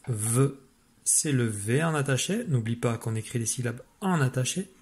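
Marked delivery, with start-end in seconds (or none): 0.1-0.5 V said as its sound "veu"; letter sound. Shown as a demonstration. Teacher giving pupils the sound of the letter, V sounded out as "veu". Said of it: "la lettre qui fait le son V".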